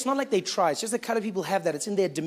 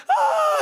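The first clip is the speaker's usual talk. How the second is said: a sudden high yelp of fright; high scared shriek; 5 dB louder than usual. Retold or imitated. Coloured woman shrieking in mock fear. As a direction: shriek as if startled. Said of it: "the colored woman would be like".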